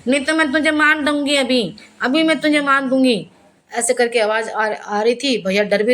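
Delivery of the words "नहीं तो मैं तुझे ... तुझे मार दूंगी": a higher, nasal, menacing threat from a possessed woman; an aunt possessed in a pit, nasal and higher, threatening her rescuer. Retold or imitated. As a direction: threaten through the nose like something inside her is speaking. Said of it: "नाक से उनकी आवाज़ आती थी".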